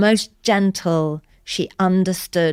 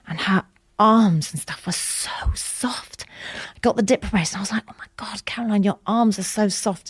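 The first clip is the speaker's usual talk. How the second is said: hushed whisper, slightly higher; awed whisper; 4 semitones higher than usual. Real, not acted. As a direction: whisper it in wonder. Wonder at sister's soft skin.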